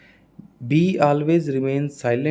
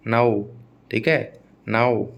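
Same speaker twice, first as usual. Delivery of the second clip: ordinary talk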